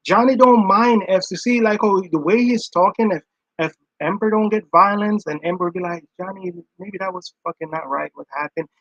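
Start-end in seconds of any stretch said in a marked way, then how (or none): 6.2-8.7 softer, level, reasonable tone; calm voice. Pretend. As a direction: ask it quietly without blowing up. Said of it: "talk to him in a calm voice".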